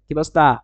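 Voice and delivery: "কি বস্তা" run together, punchy; quick two-beat "কি বস্তা"; fast contracted form. Shown as a demonstration. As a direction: swallow the first vowel of obostha in "কি বস্তা". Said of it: "fast বলি এরকম হয়ে যায়".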